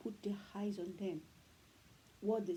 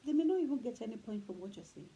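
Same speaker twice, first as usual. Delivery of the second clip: usual speaking voice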